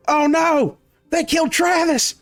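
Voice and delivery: high-pitched hammed-up yokel outrage; distraught bandit. Bandit mourns Travis and attacks, high outraged wail. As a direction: wail for a fallen friend, high. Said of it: "walks up to you and goes".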